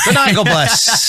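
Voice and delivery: high, slow laughing goodnight; laughing sign-off. Real, not acted. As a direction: sing it out through a laugh.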